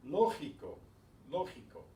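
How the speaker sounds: Lógico stressed on LO, falling after it; Spanish LÓ-gi-co with the accent up front; stress on accented syllable. Shown as a demonstration. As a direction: punch the first syllable of Lógico. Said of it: "put the stress on that".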